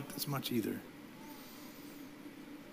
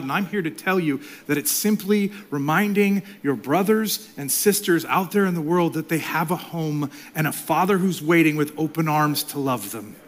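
normal talking voice

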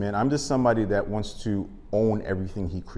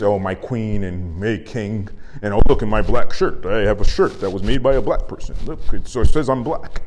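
mock fawning fan; 7 dB louder than usual. Comic mocking a fake supporter, gushing and loud. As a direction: parody a fake-woke fan showing off his shirt. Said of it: "Everything is".

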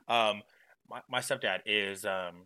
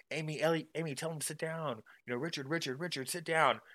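higher-pitched mock pleading; imitated pleading relatives; 6 semitones higher than usual. Aunts and grandma begging Amy and Richard, mock pleading. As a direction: call out names like worried aunts.

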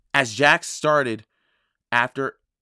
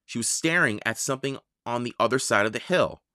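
usual speaking voice

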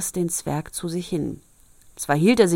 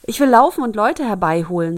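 eager offer; 3 semitones higher, 8 dB louder than usual. Acted. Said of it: "sagte Rosenrot".

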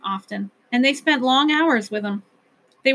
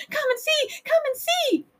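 tiny high creature voices, softer; little Flukes crying; 3 dB quieter than usual. A narrator's reading. Flukes urging the Whangdoodle to come, tiny and high. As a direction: pipe it up small and eager. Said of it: "cried the little Flukes".

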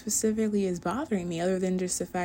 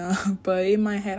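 ordinary delivery

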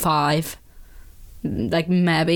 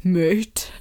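a drawn-out posh North Down "Mate." with a rising tune; lightly mock a posh North Down lad saying "Mate."; a posh North Down "Mate." imitated for a laugh; posh North Down accent. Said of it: "really posh accent".

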